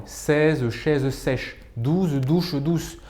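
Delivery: every syllable of "Seize chaises sèches" said very slowly and clearly; slow tongue twister. Shown as a demonstration. Coach demonstrating a tongue twister to students, very slowly. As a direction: articulate each sound slowly, like a diction drill. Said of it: "prononcer de façon régulière des vire-langues".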